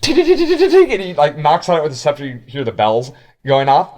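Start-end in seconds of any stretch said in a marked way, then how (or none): 0.0-0.9 high, laughing tick tick; knocking sounds. Acted. Guide imitating knocking on Noska's door. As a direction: tap out the knocks in a squeaky voice. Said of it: "he goes".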